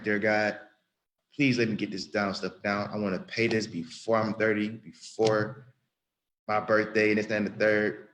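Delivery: ordinary talk, nothing marked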